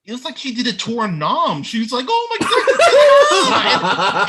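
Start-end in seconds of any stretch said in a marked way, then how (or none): 2.1-4.3 much higher, slow and louder wail; melodramatic wail. Retold or imitated. Mocking Bella's catatonic breakup, a slow high wail. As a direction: ham up a swooning despair.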